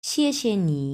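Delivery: xièxie nǐ with Mandarin tones; 谢谢你, 标准普通话声调; Chinese xièxie nǐ. Shown as a demonstration. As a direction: fourth tone falling on শিয়ে, then light neutral শিয়ে, dipping নি. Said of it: "thank you আমরা কিভাবে বলবো Chinese ভাষাতে?".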